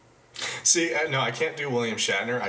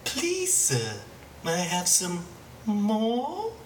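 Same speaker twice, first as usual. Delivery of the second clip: much higher cartoon baby voice; Stewie impression; 9 semitones higher, much slower than usual. Host retrying Stewie, higher and squeakier. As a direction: squeak it out like a prim toddler. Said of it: "I could probably do Stewie".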